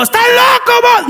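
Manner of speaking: very high yell to someone far away; hollering from a distance; long-distance shout. Acted. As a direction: call it out as if across a field. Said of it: "No lo tiene lejos así".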